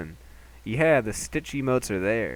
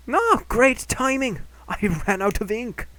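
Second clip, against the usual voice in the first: high, slow character voice; a higher cheery shopkeeper; 11 semitones higher, 4 dB louder than usual. Acted.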